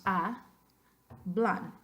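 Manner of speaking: hablan broken as "a", pause, "blan"; split into syllables. Shown as a demonstration. Teacher dividing hablan for the class, syllable by syllable. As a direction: say "a... blan", each syllable on its own. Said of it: "cómo dividir las sílabas".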